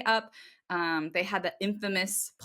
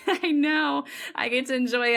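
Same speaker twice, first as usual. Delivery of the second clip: laughing as you say it; 6 semitones higher, 4 dB louder than usual. Real, not acted. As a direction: laugh through the words. Laughing at a California joke.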